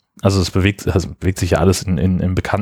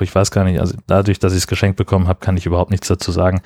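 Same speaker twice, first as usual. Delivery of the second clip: usual speaking voice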